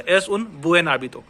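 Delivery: slow "Es un buen hábito", words apart; slow speech. Shown as a demonstration. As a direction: keep buen separate from hábito. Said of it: "धीरे से बोल रहा हूं".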